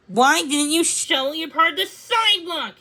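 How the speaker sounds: high, loud furious shout; angry yell. A narrator's reading. Owen after slipping, yelling at the shopkeeper. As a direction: yell the blame at him. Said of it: "he yelled".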